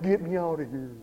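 soft, low, defeated plea; mock despair fading away; disgusted. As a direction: mutter it as a hopeless prayer. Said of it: "we become disgusted with the world".

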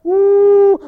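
one long, high "woo"; high whoop. Real, not acted. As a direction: let out a sustained whoop. Exclamation during the sermon.

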